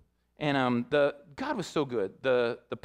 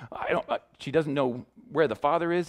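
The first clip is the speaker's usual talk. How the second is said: ordinary talk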